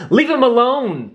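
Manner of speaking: high, urgent plea; pleading. Acted. Roo begs the hunters for Lumpy, high urgent plea. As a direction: beg them to stop. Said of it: "pleaded Roo".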